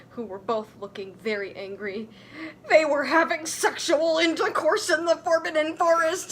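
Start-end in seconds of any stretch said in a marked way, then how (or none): 2.7-6.3 loud, higher furious report; furious yell. Acted. Dumbledore reporting to the professors, furious yell. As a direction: yell it out, scandalised. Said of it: "he yelled in a furious voice".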